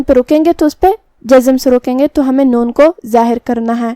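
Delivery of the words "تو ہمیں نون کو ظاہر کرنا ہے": usual speaking voice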